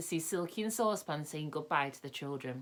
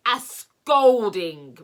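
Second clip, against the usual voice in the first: slow, much louder roar; 5 semitones higher, 14 dB louder, much slower than usual. A narrator's reading. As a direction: bellow it out like an angry pixie. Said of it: "roared the angry pixie".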